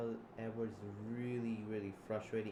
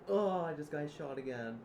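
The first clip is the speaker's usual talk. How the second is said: loud, high, acted cry of pain; hurt cry; 6 semitones higher, 7 dB louder than usual. Reacting to being shot in the story, acted hurt wail. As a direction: wail it, leaning on the "oh".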